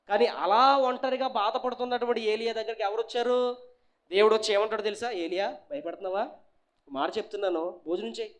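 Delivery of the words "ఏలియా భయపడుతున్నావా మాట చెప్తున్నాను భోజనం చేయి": softer, tender reassurance; gentle voice of God. Retold or imitated. God gently reassuring frightened Elijah, soft and tender. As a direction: speak softly like God soothing a frightened prophet.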